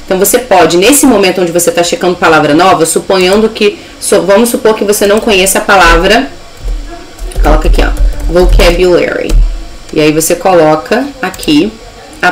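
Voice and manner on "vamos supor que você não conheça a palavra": ordinary talk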